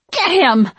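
loud, high-pitched shriek. A narrator's reading. Winifred attacked by a cat, shrieking. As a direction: cry out shrilly in panic and anger. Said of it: "Winifred shrieked".

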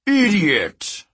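high, forceful royal rebuke; booming outburst. A narrator's reading. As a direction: explode at a foolish underling. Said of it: "Porphyrion's voice shook the ruins".

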